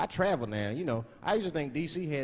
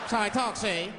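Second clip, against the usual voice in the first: forties bad guy; 5 semitones higher than usual. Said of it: "how bad guys used to talk in the forties".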